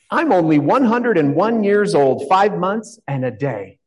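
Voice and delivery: Queen declaring. Retold or imitated. Pastor voicing the Queen of Wonderland, proud declaration. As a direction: announce her age with royal pride. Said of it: "The Queen had declared".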